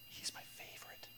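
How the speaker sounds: hushed, voiceless whisper; barely audible, intimate whisper; confiding whisper. Acted. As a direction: whisper it like a treasured secret. God confiding about the neighbor.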